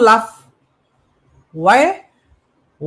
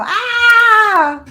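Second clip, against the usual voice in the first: high, sudden laughing "Ah!"; hammed-up laughing exclamation; mock laugh; 14 semitones higher, 5 dB louder than usual. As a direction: burst out in a laughing cry. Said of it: "I laugh".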